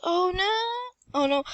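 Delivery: gaming panic cry; panicked. Real, not acted. Panic in game.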